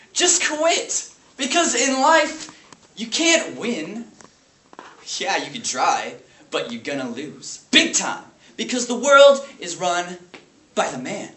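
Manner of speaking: loud, high, bitter lecture; raging substitute teacher. Acted. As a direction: lash out at the class in emphatic bursts. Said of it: "lash out his rage at the small private school".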